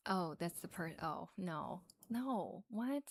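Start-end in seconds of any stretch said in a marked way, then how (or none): none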